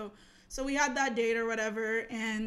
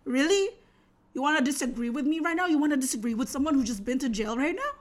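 louder, quick challenge; acted-out affronted challenge, raised voice; mock confrontational; 3 semitones higher, 5 dB louder, faster than usual. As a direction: imitate an indignant date picking a fight. Retells date's confrontational challenge.

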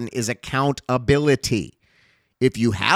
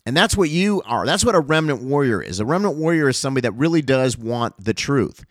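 fiery higher-pitched sermon; preaching; 5 semitones higher than usual. Acted. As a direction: preach it with rising fervor. Preaching to congregation at full force.

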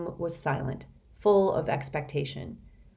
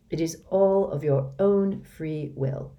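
slow and deliberate. Acted.

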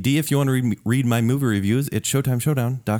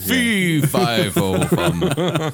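giant voice; 6 semitones higher, much slower than usual. Pretend. Giant joke.

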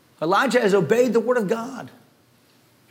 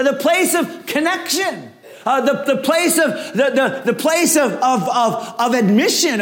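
higher, pleading sermon voice praying as Elijah; fervent Elijah prayer; 5 semitones higher than usual. Acted. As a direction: cry out to the Lord on Elijah's behalf.

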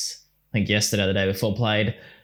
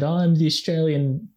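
high mocking child voice; childish put-on voice; kid's voice; 7 semitones higher than usual. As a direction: mimic a smug little kid, pitched up. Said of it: "how he put on that kid's voice".

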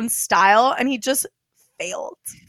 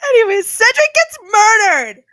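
laughing; 9 semitones higher, 4 dB louder than usual. Real, not acted. Laughing mock-wail about death.

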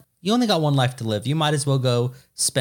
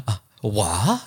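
mock German confusion; 6 semitones lower than usual. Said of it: "they're gonna be like".

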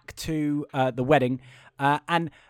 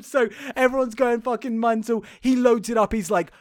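ordinary talk

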